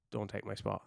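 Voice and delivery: muttered warning. Retold or imitated. Bloodied wrestler scolding the rookie for taking his spot, hushed. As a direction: say it under your breath, territorial. Heinze scolds him after show.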